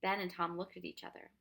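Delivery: plain reading voice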